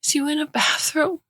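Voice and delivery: put-on high child voice on the edge of a sob; a small boy about to cry; toddler near tears. Anxious toddler asking where his mother is, voice edged with a sob. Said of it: "Jack's voice is edged with a sob".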